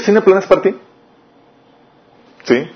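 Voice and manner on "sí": usual speaking voice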